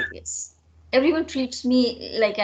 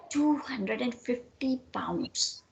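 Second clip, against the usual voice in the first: slow, proud whisper; 7 dB quieter, slower than usual. Acted. Nora whispers the sum to her friend, proudly. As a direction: breathe the sum like a treasure.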